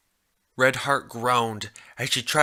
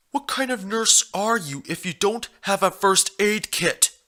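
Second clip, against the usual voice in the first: higher, odd-sounding stallion voice, matter-of-fact; the stallion, smugly insistent; 8 semitones higher than usual. A narrator's reading. Stallion lectures the hurt nurse, insistent and higher. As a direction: lay out his logic as if it's obvious.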